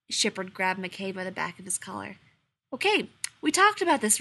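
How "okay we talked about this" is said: high, firm warning. A narrator's reading. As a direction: warn him to stop now. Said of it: "he warned".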